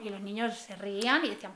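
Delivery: ordinary talk, nothing marked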